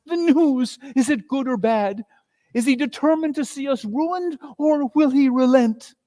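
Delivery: urgent wife at the door; anxious wife. Acted.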